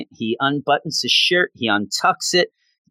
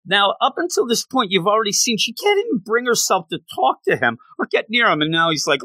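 ordinary delivery

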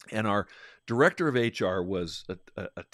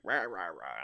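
mock ranting noise; 3 semitones lower, 5 dB quieter than usual. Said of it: "she's like".

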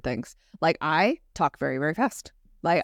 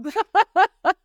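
burst of laughter; 13 semitones higher, 6 dB louder than usual. Real, not acted. Laughs at her own bro voice.

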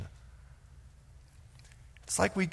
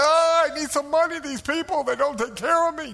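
put-on high kvetching Yiddish accent; 8 semitones higher than usual. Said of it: "a little oy vey goes involved".

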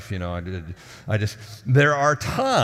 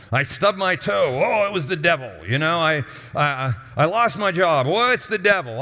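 mock complaint; 6 semitones higher, 4 dB louder than usual. Mocking people blaming the devil.

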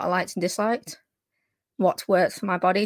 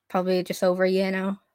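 usual speaking voice

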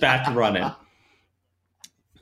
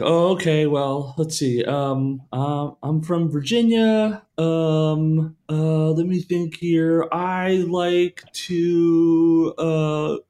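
higher, slow, halting answer with ums; hesitant trainee; 6 semitones higher, much slower than usual. Retold or imitated. Trainee answering the new trainer, slow and halting. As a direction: stall for time like a nervous trainee.

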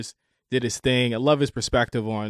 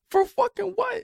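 shrill high disbelief, almost a squeak; incredulous. Real, not acted. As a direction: balk at the price, voice jumping up. Shocked at paying for membership.

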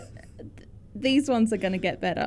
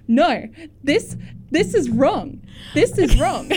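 higher, louder mock protest; combative mimicry; 5 semitones higher, 5 dB louder, slower than usual. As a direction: imitate someone indignantly fighting every opinion. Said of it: "you're just fighting these opinions".

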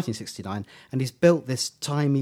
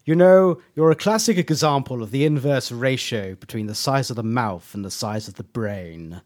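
loud theatrical attack, easing down by the end; grand impersonation of an insulting Doctor; Tom Baker; 5 dB louder than usual. Retold or imitated. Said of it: "this is Tom Baker's voice".

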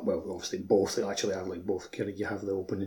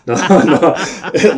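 loud laughing protest, no no no; 6 semitones higher, 15 dB louder than usual. Real, not acted. Laughing loudly as he takes back his joke verdict.

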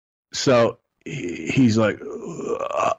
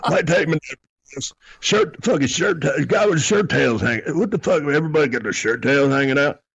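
higher, offended ranting about shirt tails; mock disgusted rant; 6 semitones higher than usual. Bobby complaining to the driver about the bar crowd, in a hammed-up offended rant. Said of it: "he kept saying".

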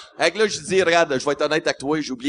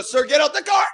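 officer yelling; 11 semitones higher than usual. Retold or imitated. Guard repeats the order, shouting.

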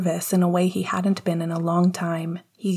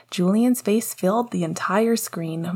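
ordinary delivery

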